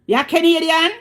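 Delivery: high, loud incredulous officer; mimicked officer. Officer looking at the driver's ID, loud mimicked disbelief. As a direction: imitate an officer's loud, high-pitched query.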